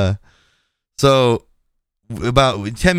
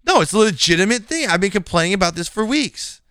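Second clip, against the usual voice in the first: high-pitched self-defence; retold indignant rebuttal; indignant protest; 7 semitones higher than usual. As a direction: push back against the accusation, voice raised in pitch. Acted argument, defending against excuses charge.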